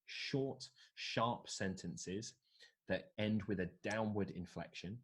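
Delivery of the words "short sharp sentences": SHORT and SHARP set apart with slow pauses; slow, separated stress on "short, sharp sentences". Shown as a demonstration. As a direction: punch "short", then "sharp", each on its own. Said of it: "you wanna be stressing short, sharp sentences".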